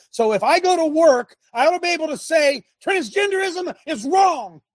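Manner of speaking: fired-up shout. Real, not acted. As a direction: hammer the point home like a preacher. Preaching outrage at the audience.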